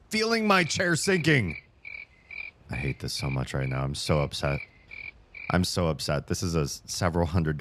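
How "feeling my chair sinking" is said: alarmed. Real, not acted. Streamer feeling her chair sink again, high alarm. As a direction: squeal it as the seat goes.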